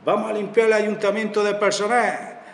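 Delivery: high, sustained rally slogan; mimicking an electioneering slogan, pitched well up; campaign slogan. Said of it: "algo, un lema así".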